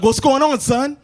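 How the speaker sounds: lightly played protective parent; protective dad. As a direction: act out a dad rushing to check. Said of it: "my protective instincts".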